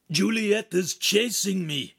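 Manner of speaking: high, slow, strained report; out-of-breath croak. A narrator's reading. Ugolino warning Feltrino he is being chased, breathless croak. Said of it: "Ugolino croaked, out of breath".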